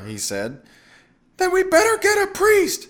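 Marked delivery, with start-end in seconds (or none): 1.4-2.9 very high, loud yell; wife's piercing shout; screaming over noise. A narrator's reading. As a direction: shriek the suggestion over a din. Wife screaming over banshee din.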